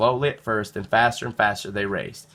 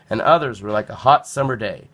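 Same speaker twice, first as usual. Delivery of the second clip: ordinary delivery, nothing marked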